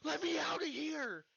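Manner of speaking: high, wailing cry to be let out; trapped and begging in a strained high voice; panicked pleading. Retold or imitated. Friend locked in bathroom, begging out.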